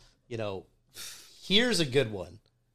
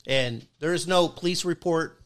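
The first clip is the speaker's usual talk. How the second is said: usual speaking voice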